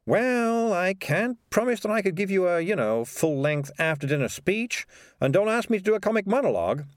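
a clever cat making his case; a hint of a sly, chatty cat; Morris the cat.

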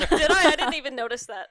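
laughing mock innocence. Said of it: "you're like".

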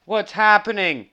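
panicked. Retold or imitated. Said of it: "And you're like".

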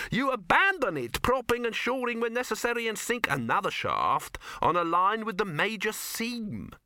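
very high, lecturing dwarf voice; expansive dwarf. A narrator's reading. Dwarf giving a long mining explanation, expansive. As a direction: expound leisurely in a high pitch. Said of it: "expansive manner of one about to settle down to a good long explanation".

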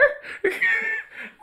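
squealing high laugh. Real, not acted. Loud laugh.

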